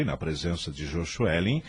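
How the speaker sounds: ordinary delivery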